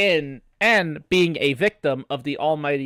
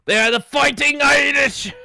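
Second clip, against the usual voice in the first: put-on loud hoarse Irish accent shout; 12 semitones higher, 9 dB louder than usual. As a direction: yell it like an Irish fan. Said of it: "you tried so hard with that accent".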